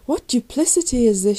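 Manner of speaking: loud, high outraged shout. A narrator's reading. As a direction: shout in anger at the betrayal. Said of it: "shouted Meldrick".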